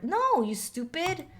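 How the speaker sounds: high, loud and slow scolding; mock angry yell. Daughter scolding friend in text, loud slow yell. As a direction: bellow it like a furious teen.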